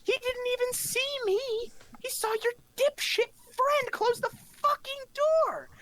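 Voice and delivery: shrill indignant outburst. Acted. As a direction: squeal it out in disbelief. Character arguing, accusing.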